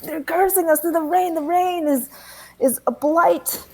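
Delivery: high, loud doomsayer's wail; screaming. Acted. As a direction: wail wildly at a crowd, slowing at the end. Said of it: "before you start screaming".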